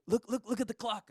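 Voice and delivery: high, loud and insistent; excited urging. Retold or imitated. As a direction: point at it, bursting with excitement. Urging girlfriend to look.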